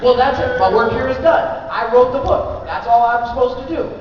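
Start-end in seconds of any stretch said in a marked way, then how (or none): 0.6-1.7 amused, louder and higher; laughing emphasis. Real, not acted. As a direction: say it with a chuckle, loud. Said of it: "I'm like the sheriff".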